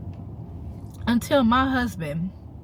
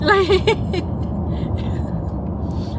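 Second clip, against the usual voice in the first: breathy giggle she tries to hold in; real laugh breaking through; stifled laugh; 11 semitones higher than usual. Real, not acted. Driving, trying not to laugh at herself: stifled giggle. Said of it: "I'm trying not to laugh".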